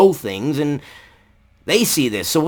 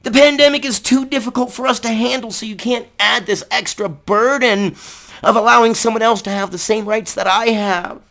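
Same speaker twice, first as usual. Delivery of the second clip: mocking whiny opponent; 8 semitones higher than usual. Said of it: "I know they're gonna say".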